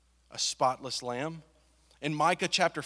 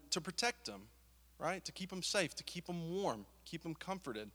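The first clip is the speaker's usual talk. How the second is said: tender; 9 dB quieter than usual. Real, not acted. Preacher to congregation on protecting babies, tender and soft. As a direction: ease down as if soothing a newborn. Describing babies kept safe.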